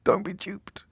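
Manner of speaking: soft breathy echo of the warning; whispered warning. Retold or imitated. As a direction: whisper it like a secret caution. Echoing the warning under his breath.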